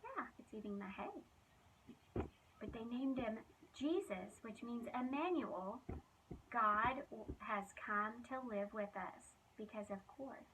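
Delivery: lively, expressive storybook reading; reading to children. Acted. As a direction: tell it like a bedtime Bible story for kids. Reads Bible story to children.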